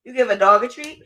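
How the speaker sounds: ordinary talk, nothing marked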